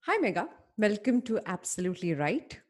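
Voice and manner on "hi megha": normal talking voice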